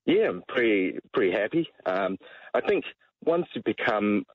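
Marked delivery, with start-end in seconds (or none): none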